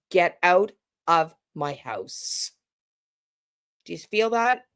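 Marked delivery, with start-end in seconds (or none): 0.1-2.5 low, slow, falling command; confrontational. Shown as a demonstration. Coach contrasting a firm homeowner, low and slow. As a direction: drop it down and mean it. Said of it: "less confrontational than".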